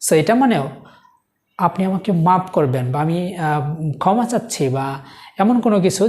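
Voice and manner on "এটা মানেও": ordinary delivery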